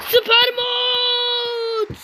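long, slow, high wailing cry; a high, slow wail of frustration; drawn-out wail. Real, not acted. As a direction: stretch it into a pained wail. Drawn out wail after falling.

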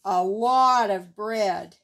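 ordinary delivery

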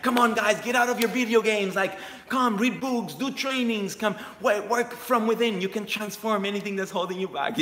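rally the gamers to wake up; imitating son's rallying cry. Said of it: "and he's like".

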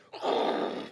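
voiceless rushing whoosh; breathy mock roar of pounding water; imitated waterfall roar. Shown as a demonstration. Preacher shows the crowd what Feather Falls sounds like, imitated roar. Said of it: "What does it sound like?".

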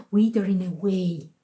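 plain reading voice

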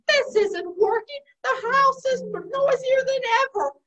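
exasperated. Acted. Spider complains to Wise Owl, exasperated.

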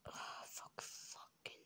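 breathy whispered swearing; whispered curse. Real, not acted.

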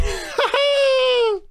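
very high whooping cackle; high-pitched gleeful whoop; elated squealing laugh. Real, not acted. Celebrating the equaliser.

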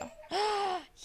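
a sharp excited intake of breath; delighted gasp. Real, not acted.

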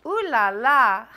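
loud delighted ooh la la; fancy exclamation. Acted. Nancy showing off her fancy French, loud and delighted. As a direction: gush it out like a fancy lady.

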